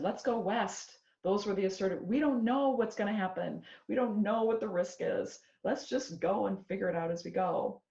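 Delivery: rally everyone to head out; gung-ho, a bit hammed-up; bold pioneer call. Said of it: "the pioneers who said".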